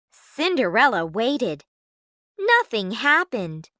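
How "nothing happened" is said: ordinary delivery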